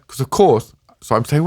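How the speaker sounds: ordinary delivery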